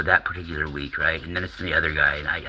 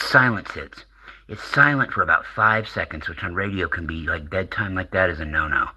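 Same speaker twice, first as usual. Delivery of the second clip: ordinary talk, nothing marked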